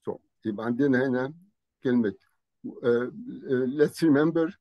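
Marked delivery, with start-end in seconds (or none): none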